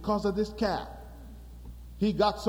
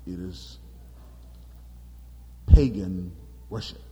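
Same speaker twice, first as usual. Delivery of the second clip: low grave voice; 13 semitones lower than usual. Acted. Joshua declares grave news.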